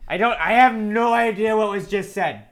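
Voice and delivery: high-pitched mock confusion; baffled quarterback. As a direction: pitch it up in total bewilderment. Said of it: "quarterback's just like".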